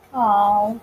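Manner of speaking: ordinary talk, nothing marked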